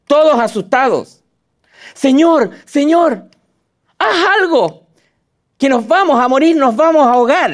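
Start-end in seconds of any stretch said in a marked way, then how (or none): 1.9-7.5 high, frantic cry for help; panicked disciples. Acted. Frightened disciples crying out to Jesus, panicked plea. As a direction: plead with the Lord as the boat sinks.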